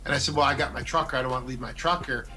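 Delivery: ordinary talk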